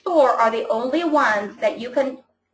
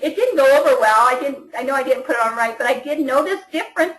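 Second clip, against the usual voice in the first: usual speaking voice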